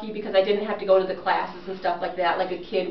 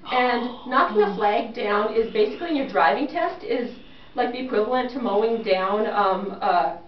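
normal talking voice

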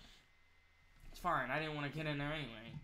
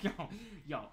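laughing; 6 semitones higher, 6 dB louder, much faster than usual. Real, not acted. Laughing at the picture.

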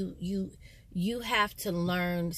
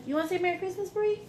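high, quick coaxing voice; 12 semitones higher, much faster than usual. Real, not acted. Prompting child to speak.

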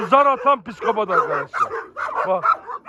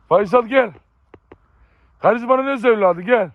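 calling a dog; 5 semitones higher, 4 dB louder than usual. Real, not acted. Farmer calls Faysal over again, a high loud call.

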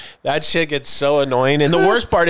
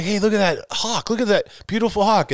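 put-on old-man wonder; 7 semitones higher than usual. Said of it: "I'm like".

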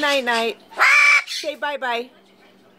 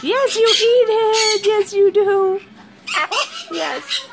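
high, slow sing-song coo at a bird; pet talk; 7 semitones higher, slower than usual. Real, not acted. Owner praising her parrot, high cooing pet talk.

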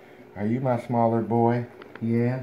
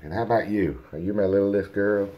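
usual speaking voice

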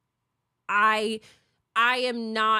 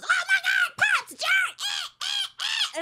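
high-pitched shrieking fan imitation, much higher; mock fan screaming; slower than usual. Imitating fans yelling at her famous father-in-law on the stairs, shrieking high. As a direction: squeal like starstruck fans swarming.

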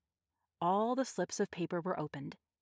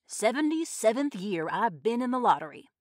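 loud, higher character voice; Old Man Warner; 4 semitones higher, 7 dB louder than usual. A narrator's reading. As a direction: announce it loudly as an old-timer. Old man speaking in crowd.

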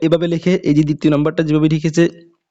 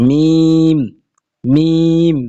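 emphatic letter drill; 5 dB louder, much slower than usual. Shown as a demonstration. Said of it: "মাঝখানে আসলে এভাবে লিখে".

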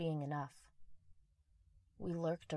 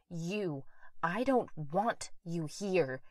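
louder and louder, slowing into a yell; furious shout; 10 dB louder, much slower than usual. Acted. Mother yelling at the ghost of her son to leave. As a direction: drive him away with every word. Mother jabs finger at illusion.

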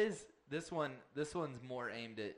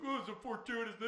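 mocking imitation; 8 semitones higher, faster than usual. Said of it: "making fun of him".

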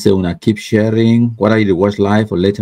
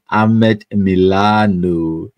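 ordinary delivery

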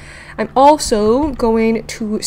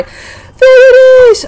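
higher, much louder, slow triumphant cry; relieved excitement; 12 semitones higher, 9 dB louder, much slower than usual. Real, not acted. Gamer spots the oxygen plant, loud relieved cry. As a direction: shout it out with huge relief.

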